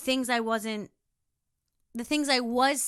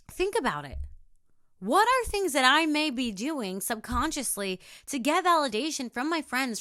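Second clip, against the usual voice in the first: normal talking voice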